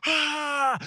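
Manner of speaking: high shouted cheer; kids cheering. Retold or imitated. Kids answering the soldier with a shouted cheer.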